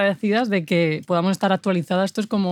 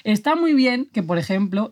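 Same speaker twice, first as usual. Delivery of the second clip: ordinary talk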